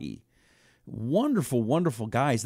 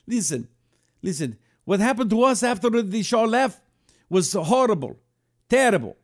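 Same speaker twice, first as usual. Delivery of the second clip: put-on higher, louder Iranian-accented insistence; Persian accent; 8 semitones higher, 4 dB louder than usual. Owner telling Rusty of life after the Shah, Iranian accent. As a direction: press the point in a Persian accent. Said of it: "I went to the accent for a minute".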